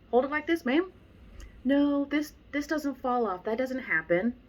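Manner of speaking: high, mocking imitation of a know-it-all; a lightly mocked stubborn student. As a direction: parrot her insistence back. Mocking lady's earlier insistence.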